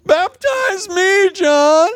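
slow, high-pitched mock request; high mock plea. Jesus asking John to baptize him, high and slow. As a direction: stretch it out high and pleading.

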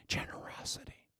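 breathy single-word whisper. Acted. Hushed key word.

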